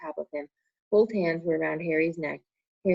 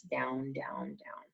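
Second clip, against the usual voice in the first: fading to a whisper, much softer and lower; fading away; 4 semitones lower, 11 dB quieter, much slower than usual. A narrator's reading. Harry falling into blackness, fading to a whisper. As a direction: let it sink and fade.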